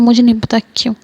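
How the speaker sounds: ordinary delivery, nothing marked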